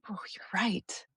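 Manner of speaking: hushed, caught off guard; taken-aback whisper. Retold or imitated. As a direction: whisper the realization softly.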